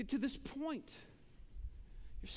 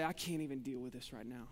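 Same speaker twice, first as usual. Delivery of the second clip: low, softer admission of being overwhelmed; subdued overwhelmed quote; 9 semitones lower, 6 dB quieter than usual. Acted. Preacher voicing someone overwhelmed on a routine basis, subdued. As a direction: say it low and soft, worn down by it. Overwhelmed person's saying, first take.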